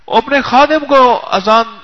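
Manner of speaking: slow, loud call across the palace. Retold or imitated. Guest calling his servant across the palace, slow and loud. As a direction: drag the words out like a loud summons.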